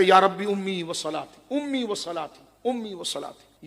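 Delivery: slow, fading plea to God; pleading yet holding back; slow torn prayer. Retold or imitated. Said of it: "لیکن کہتے".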